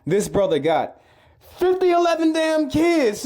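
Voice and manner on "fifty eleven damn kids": mock outrage. Real, not acted. Exaggerated punchline outrage.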